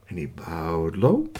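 slow low narration. A narrator's reading.

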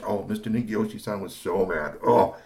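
ordinary delivery, nothing marked

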